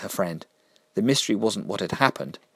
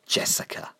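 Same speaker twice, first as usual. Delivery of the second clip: breathy hissed whisper of her name; 4 dB quieter than usual. A narrator's reading. Killer hissing at Jessica in a whisper. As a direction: hiss the name like a predator. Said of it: "hissed the killer".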